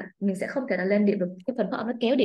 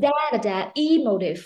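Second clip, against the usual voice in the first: DA-da, then "emotive" with E hit hardest; first-syllable stress; 2 semitones higher, 3 dB louder than usual. Shown as a demonstration. Teacher showing stress position one, E-motive with first syllable strong. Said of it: "Âm một phải là".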